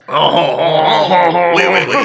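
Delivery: hammed-up snooty French laugh-talk; mock stuck-up French. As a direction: play a caricature of a haughty French speaker. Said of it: "sounding like a stuck-up asshole".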